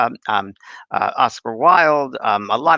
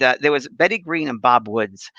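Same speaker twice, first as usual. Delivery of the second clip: usual speaking voice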